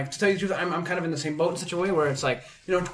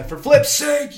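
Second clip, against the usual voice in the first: higher, louder mock curse; exasperated exclamation; 8 semitones higher, 7 dB louder, much slower than usual. Shown as a demonstration. Friend acts out his go-to swear substitute, loud and exasperated. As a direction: blurt the tame curse out exasperated. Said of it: "one of my go-to lines is".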